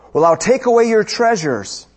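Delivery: threatening emperor. Retold or imitated. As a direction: imitate an emperor raising his voice to threaten. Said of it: "the emperor threatened him".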